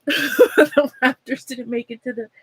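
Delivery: laughing it out mid-sentence. Real, not acted. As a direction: chuckle through the bad news. Laughs while repeating team loss.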